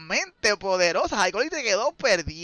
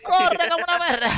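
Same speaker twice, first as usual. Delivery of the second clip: real excited yelling, pitched way up; frantic urging; 7 semitones higher than usual. Real, not acted. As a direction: shout at the player to run. Caster urges player to flee.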